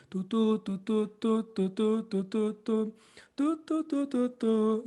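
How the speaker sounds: tongue "tu" evenly along the phrase; "tu" syllables in a light melodic line; articulated tu syllables. Shown as a demonstration. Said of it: "E o tu".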